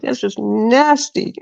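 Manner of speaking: exaggerated squeamishness; retold disgust. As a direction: stretch it out in mock revulsion. Retold disgust at mom's remark.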